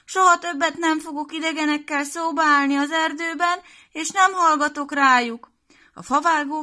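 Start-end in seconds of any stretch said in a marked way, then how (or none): 0.0-5.5 put-on high, loud child promising; a little girl swearing she's learned; tearful little girl vow. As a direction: Piroska promises grandma, high and earnest.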